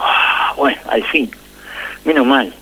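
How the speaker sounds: sighed-out relief, half whispered; relieved sigh. Retold or imitated. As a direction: let the breath out, relieved at last. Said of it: "sentí un suspiro".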